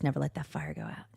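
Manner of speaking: soft unvoiced whisper. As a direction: hush it like a secret. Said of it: "so Sparky says".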